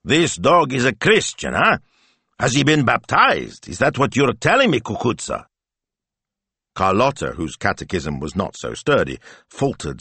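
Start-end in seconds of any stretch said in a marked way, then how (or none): 0.0-5.5 huffy grandfather, pitched up; exasperated grandpa. A narrator's reading. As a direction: challenge the child in mock disbelief. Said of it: "Signor Speranza blew upwards".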